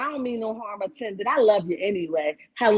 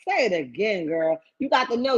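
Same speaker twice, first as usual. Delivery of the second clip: normal talking voice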